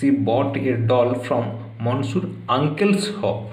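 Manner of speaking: each word slow, spaced out for students; slow, careful model reading; slow dictation. Shown as a demonstration. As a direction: read it out slowly for dictation. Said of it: "এবার কেউ এখানে এরকম করতে পারে".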